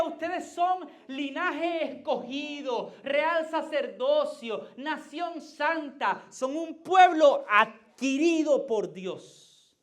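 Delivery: slow, loud, high declaration of the verse; Peter's words boomed slowly from the pulpit; proclaiming scripture. Retold or imitated. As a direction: lay out each phrase slowly like a proclamation. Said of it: "lo que decía Pedro cuando decía".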